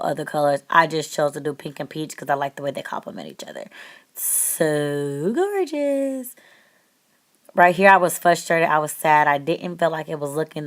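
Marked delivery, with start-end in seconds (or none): 4.1-6.3 very slow, stretched-out, higher gush; admiring. Real, not acted. Vlogger admiring her eyeshadow, slow drawn-out gush. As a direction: linger over it like you're in love.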